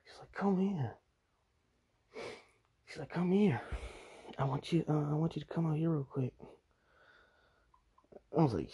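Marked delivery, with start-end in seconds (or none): 0.3-1.0 high put-on summons; higher-pitched mimicked coaxing; imitated beckoning girl. Voices the girl beckoning him. 3.1-6.3 higher put-on coaxing voice; high, insistent mimicked request; imitated beckoning girl.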